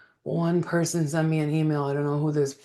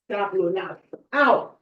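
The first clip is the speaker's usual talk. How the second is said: normal talking voice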